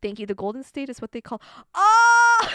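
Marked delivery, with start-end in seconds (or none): 1.8-2.5 very loud, high cry of dawning realisation; shocked realisation. Real, not acted. Streamer realising what Golden State means, loud shock. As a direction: let out a big loud "oh" as it clicks.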